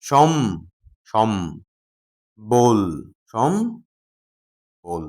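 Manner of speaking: সম and বল said as separate syllables with pauses; split into syllables. Shown as a demonstration. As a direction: break সম্বল into সম, then বল. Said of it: "কি বলছি?".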